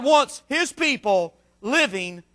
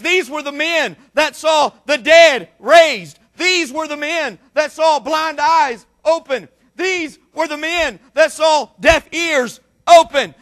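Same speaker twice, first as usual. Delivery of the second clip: fervent preaching; 2 semitones higher, 5 dB louder than usual. Real, not acted. Preacher recounting miracles the disciples saw, fervent preaching. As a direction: hammer each phrase home with stretched key words.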